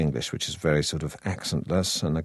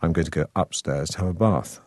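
RP accent, long A in "bath"; received pronunciation. Shown as a demonstration. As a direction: taught drama-school RP. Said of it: "when you do receive pronunciation, then you have to say".

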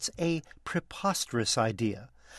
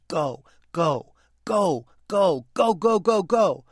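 louder, higher repeated urging, building in intensity; urgent cheering-on; 5 semitones higher, 7 dB louder, slower than usual. Retold or imitated. Film critic reliving the climax, urgent building shout. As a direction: urge them on, building to a loud, high cry. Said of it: "had me leaning forward saying".

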